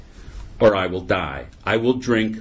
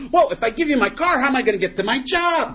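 high-pitched, worked-up objection; comic protest. Acted. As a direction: protest the price as a panicking drunk in a joke. Acted drunk protesting the price.

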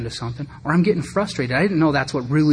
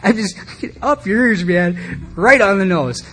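higher and louder, mock offended; mock indignant outburst; 7 semitones higher, 5 dB louder, slower than usual. Stung by the friend's truth, hammed-up outburst. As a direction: throw it back like a playful insult.

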